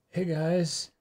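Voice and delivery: ordinary talk, nothing marked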